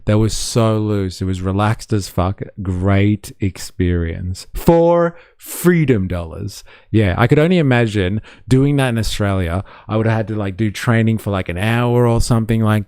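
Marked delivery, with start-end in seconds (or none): 4.5-6.6 slow, higher mock-American "freedom dollars"; a higher, slower mock-American voice; mock patriot voice. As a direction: say it like a proud American ad.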